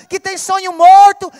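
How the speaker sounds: "morto" shouted out in a powerful preacher's cry; loud proclamation. Acted. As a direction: belt "sonho morto" to the back row. Proclaimed answer to dead dreams. ("sonho morto" proclamado em alta voz)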